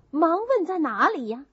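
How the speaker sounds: ordinary delivery, nothing marked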